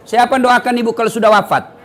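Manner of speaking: normal talking voice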